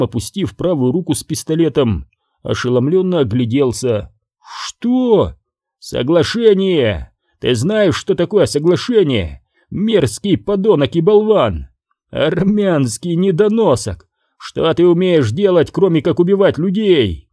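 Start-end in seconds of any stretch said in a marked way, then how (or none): 4.4-5.3 sudden high startled "Что?"; stunned what. Acted. Henchman startled by the boss's deal, high shocked question. 5.8-17.3 boss's angry tirade. Acted. Boss scolding his henchman over the deal, angry raised voice.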